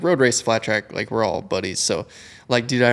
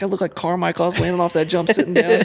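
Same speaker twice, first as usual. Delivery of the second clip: high, hyped self-mockery; laughing at his own sloppy jump; excited joking; 7 semitones higher than usual. Rider mocking his own jump to buddy, excited.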